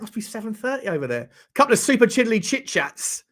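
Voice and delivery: usual speaking voice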